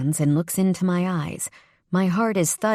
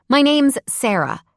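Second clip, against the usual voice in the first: snappish, higher and pointed; irritated, pitched-up retort; snapping; 8 semitones higher, 4 dB louder than usual. A narrator's reading. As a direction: snap it back at him. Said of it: "I snap".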